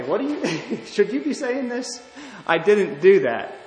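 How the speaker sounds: high-pitched, laughing pretend challenge; hammed-up high-voiced question with a chuckle; mock confrontation. Imagined confrontation of gossipers, laughing.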